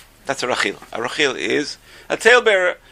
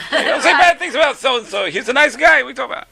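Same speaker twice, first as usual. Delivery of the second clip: high-pitched scolding over laughter; comic acted protest, pitched way up; mock indignant; 12 semitones higher than usual. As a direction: imitate someone leaping to a man's defense. Acts the indignant smacker, laughing.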